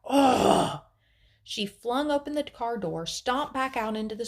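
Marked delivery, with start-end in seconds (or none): 0.1-0.8 loud exasperated growl; near-growl. A narrator's reading. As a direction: let out a big, frustrated near-growl. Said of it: "I would say almost a growl".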